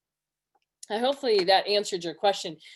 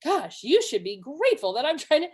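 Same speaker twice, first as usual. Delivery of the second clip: higher-pitched retold indignation; triggered; 5 semitones higher than usual. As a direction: act out a mildly affronted parent. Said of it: "so I'm getting triggered".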